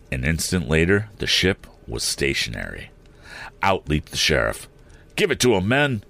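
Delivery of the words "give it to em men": rally the men with a light shout; mild shout. A narrator's reading. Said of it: "he shouted".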